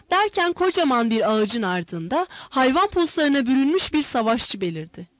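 ordinary delivery, nothing marked

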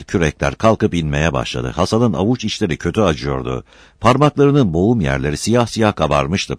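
ordinary delivery

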